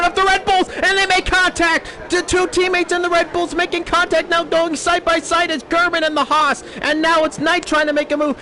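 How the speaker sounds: high, excited play-by-play; excited commentary. Real, not acted. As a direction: call the crash with rising excitement. First-lap crash, rising excitement.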